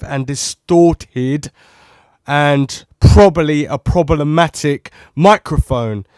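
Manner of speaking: shouting to check for distortion. Shown as a demonstration. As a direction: shout every word to push the mic. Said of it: "the next part is gonna be me shouting".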